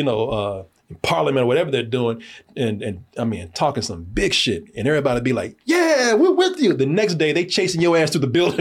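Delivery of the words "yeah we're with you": high, drawn-out mock crowd cheer; cheering supporters. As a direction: imitate a crowd rallying behind someone, much higher.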